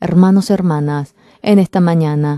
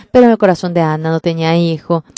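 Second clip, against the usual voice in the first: ordinary talk, nothing marked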